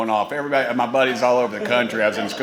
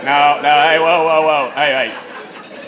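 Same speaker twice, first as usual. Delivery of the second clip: louder and higher, calling over the crowd; loud playful whoa to stop the crowd; 4 semitones higher, 6 dB louder, slower than usual. Real, not acted. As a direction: cut off the applause loudly. Stops the crowd from cheering.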